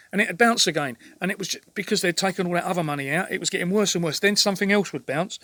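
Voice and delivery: listing every grievance, heating up; real exasperated rant building; worked up rant. Real, not acted. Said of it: "I'm getting all fucking worked up now".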